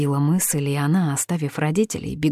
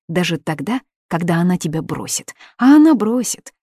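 angry accusation; 2 semitones higher, 4 dB louder than usual. Acted. Heartbroken girl accusing her lover.

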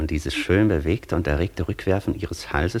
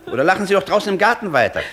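high, loud and fast, fed up; shrill-free hasty exasperated shout; exasperated outburst; 10 semitones higher, 4 dB louder, faster than usual. Acted. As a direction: blurt it out to drive her from the room. Master loses patience with laughter.

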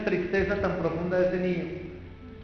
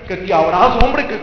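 mock threat; 11 dB louder than usual. Mock lunge at the girl.

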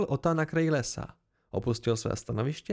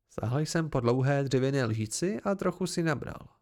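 plain reading voice

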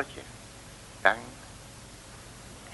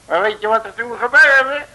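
higher and louder, proudly building to the punchline; boastful punchline build; 10 semitones higher, 8 dB louder than usual. Acted. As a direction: trumpet it like a triumph. Boastful detective delivers punchline. (opschepperig en luid naar de clou)

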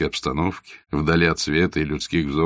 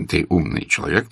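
plain reading voice

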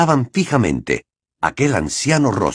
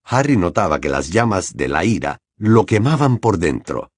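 ordinary delivery

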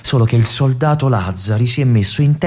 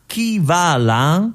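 drawn-out call of a sentry, higher and slow; slow, raised challenge to an approaching figure; sentry's call; 7 semitones higher, much slower than usual. Retold or imitated. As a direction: call out slowly like a guard at the wall. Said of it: "Per tre volte grida".

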